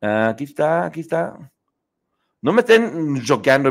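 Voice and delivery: normal talking voice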